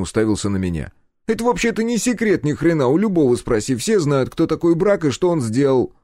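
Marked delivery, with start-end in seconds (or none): 1.3-5.9 much higher, louder, cheeky lad's voice; Step's voice. A narrator's reading. Step butting into the interrogation, cheeky high voice. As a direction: pipe up like a nosy young sidekick.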